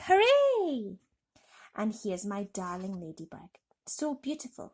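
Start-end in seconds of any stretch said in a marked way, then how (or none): 0.1-1.0 high, loud cheering hooray; crowd cheer. A narrator's reading. A dozen people cheering the visitors, high and loud. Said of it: "about a dozen people cried".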